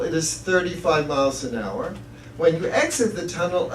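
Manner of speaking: ordinary delivery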